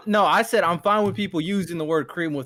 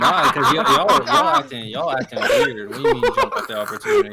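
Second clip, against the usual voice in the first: laughing; 12 semitones higher than usual. Real, not acted. Laughing protest at friends' teasing.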